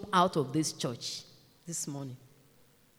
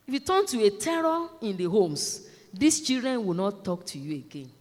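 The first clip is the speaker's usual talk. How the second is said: ordinary talk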